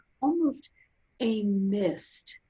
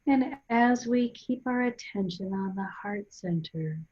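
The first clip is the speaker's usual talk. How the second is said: guided meditation. Real, not acted. Guide leading listeners to breathe and centre, calm guided meditation. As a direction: guide them gently inward, unhurried, phrase by phrase.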